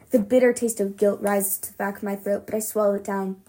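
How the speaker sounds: ordinary delivery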